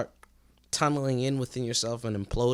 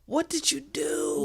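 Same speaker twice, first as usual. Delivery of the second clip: much higher, slow squeaky repeat of the question; squeaky disbelief; 14 semitones higher, slower than usual. Retold or imitated. Friend repeats the baffled question in a much higher squeak. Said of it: "And you're like".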